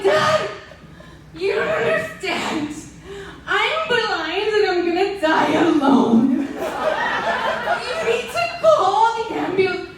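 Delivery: high, loud panicked plea; panicked yell. Retold or imitated. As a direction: yell at dad like you're dying. Panicked daughter yelling at dad.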